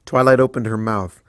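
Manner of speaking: ordinary delivery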